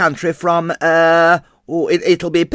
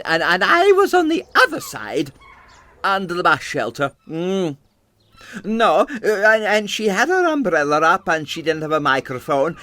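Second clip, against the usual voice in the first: put-on chatty old-country theatrical landlady; 3 semitones higher, 3 dB quieter than usual. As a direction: reminisce proudly as an immigrant seaside landlady, voice leaping up on exclamations. Plays the immigrant landlady introduced beforehand.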